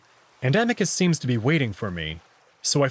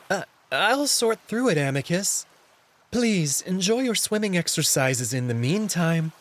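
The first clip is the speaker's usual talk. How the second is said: higher, nervous cat voice; flustered Alex; 4 semitones higher than usual. Acted. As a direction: hurry the politeness, embarrassed.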